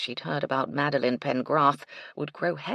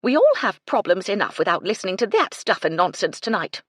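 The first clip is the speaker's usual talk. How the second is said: much louder, higher impatient scold; sharp; 5 semitones higher, 8 dB louder than usual. Acted. Phoebe rebuking Madeline, loud sharp scold. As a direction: slap down the nonsense. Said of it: "in a sharp voice".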